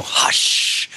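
unvoiced whispered hush. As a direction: whisper it like a creepy old lady. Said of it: "and just go".

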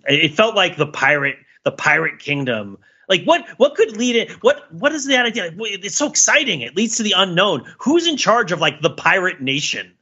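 ordinary talk, nothing marked

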